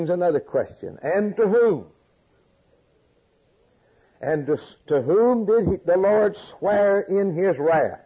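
loud, high, hammering rhetorical questions; preaching at full force. Acted. Preacher pressing the congregation with questions, loud and high. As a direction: drive each question home to the congregation.

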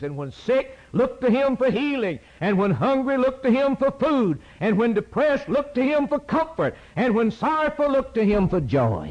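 climactic preaching litany. Real, not acted. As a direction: drive the refrain home.

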